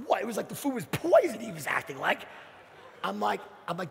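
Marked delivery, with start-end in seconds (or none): none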